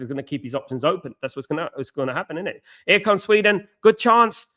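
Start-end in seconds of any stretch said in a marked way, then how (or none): 2.8-4.5 higher and louder, quick live match call; sudden burst of match excitement; excited commentary. Real, not acted. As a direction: call the chance as it happens.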